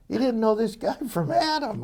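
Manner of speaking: high-pitched, laughing disbelief; amazed laughter. Real, not acted. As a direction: break into a laugh while marvelling. Amazed teller laughs at own story.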